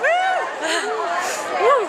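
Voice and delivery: whooping with joy, laughing; excited cheering. Real, not acted. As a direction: celebrate at the finish, whooping. Cheering at the finish line.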